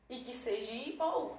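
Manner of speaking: usual speaking voice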